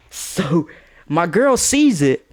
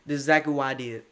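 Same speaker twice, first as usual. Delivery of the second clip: ordinary talk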